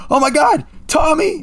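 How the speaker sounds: high-pitched mock cry of alarm; imitated yell. Player imitates an enemy finding a dead comrade, mock horrified yell. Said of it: "someone will yell like".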